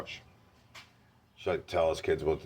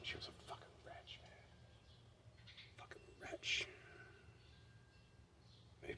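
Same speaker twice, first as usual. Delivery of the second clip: bitter whisper; 20 dB quieter, slower than usual. Real, not acted. Bitter aside about his mother.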